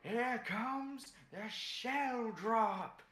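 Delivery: slow, high wrestler announcement; pro wrestler voice. Acted. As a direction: boom it out like ring entrance. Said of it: "goes in his best pro wrestler voice".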